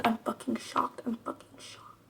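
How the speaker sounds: whispered shock; whispering. Shown as a demonstration. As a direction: breathe it as a whisper.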